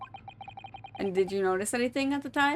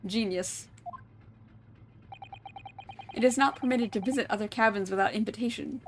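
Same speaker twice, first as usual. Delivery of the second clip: usual speaking voice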